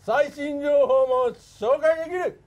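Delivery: loud, slow wrestler rally cry; slow, loud, rousing like Inoki; Antonio Inoki impression. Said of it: "モノマネで".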